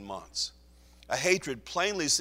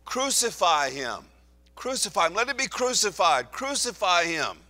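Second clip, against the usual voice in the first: louder, higher chant of the mob; crowd shouting; 6 semitones higher, 6 dB louder than usual. Acted. The crowd shouting down Pilate, loud and higher. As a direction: cry it like a baying mob. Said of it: "they all shouted and cried out".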